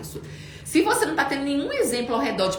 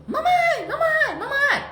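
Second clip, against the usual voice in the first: put-on high, loud child calling mamãe over and over; a little girl shouting for her mother; child yelling for mom; 4 dB louder, much slower than usual. Mother imitating her daughter yelling for mamãe. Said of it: "a Bela tava gritando muito".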